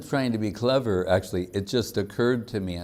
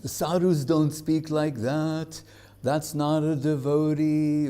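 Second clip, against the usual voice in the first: higher, slow mock-indignant protest; scandalized objection; 6 semitones higher than usual. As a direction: play the offended listeners, hammed-up. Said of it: "And everyone goes".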